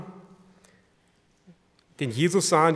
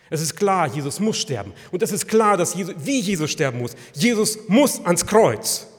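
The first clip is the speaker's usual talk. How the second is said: loud, forceful declaration; emphatic preaching; 4 semitones higher, 11 dB louder than usual. Real, not acted. Preacher corrects Pilate before the congregation, emphatic. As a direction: hammer the point home from the pulpit.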